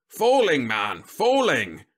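loud, drawn-out booming exclamation. A narrator's reading. General explaining to slow Whitlow, booming. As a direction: boom it out slowly and impatiently. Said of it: "the general boomed".

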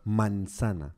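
low, soft, lingering manzana; sensual. Shown as a demonstration. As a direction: say it slowly like a seductive word. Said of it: "a la forma de decirlo".

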